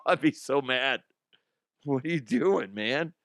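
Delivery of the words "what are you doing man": usual speaking voice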